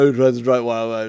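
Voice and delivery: lightly posh British voice; a touch of posh British wine snob. Pretend. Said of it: "very posh and British whenever they do this".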